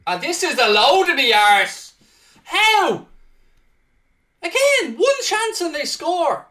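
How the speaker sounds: high, loud despairing rant; exasperated outburst. Real, not acted. Gamer conceding against the run of play, loud exasperated outburst. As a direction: let the frustration burst out.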